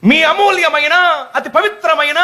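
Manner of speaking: high-pitched campaign announcer impression; election announcer. (ఎన్నికల ప్రచార గొంతును అనుకరిస్తూ)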